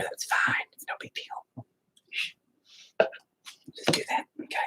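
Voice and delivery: whispered self-reassurance. Real, not acted. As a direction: whisper it to yourself. Soothing himself while painting.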